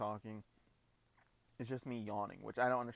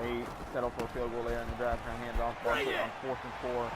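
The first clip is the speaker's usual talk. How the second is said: lively, louder sports call; play-by-play commentary; 6 dB louder than usual. Acted. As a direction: call the action like a broadcaster. Live play-by-play of own game.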